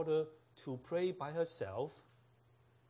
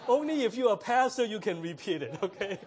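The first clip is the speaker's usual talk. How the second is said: louder, laughing through the words; can't stop chuckling at his own joke; laughing aloud; 3 semitones higher, 8 dB louder than usual. Real, not acted. Preacher laughing at his own pastor joke to the congregation, laughing loudly.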